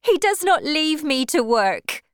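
high, loud, indignant woman; cried protest. A narrator's reading. Wife defending absent husband to suitor, indignant. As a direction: cry out in her husband's defence. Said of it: "she cried".

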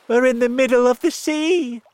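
very high, loud exclamation; alarmed cry. A narrator's reading. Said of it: "cried James".

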